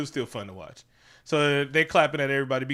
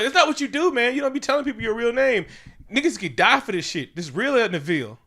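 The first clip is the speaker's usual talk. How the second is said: hammed-up scolding of a naive friend; mock scolding; 9 semitones higher than usual. He scolds Wade for sharing his real name, mock-stern. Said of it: "And he's like".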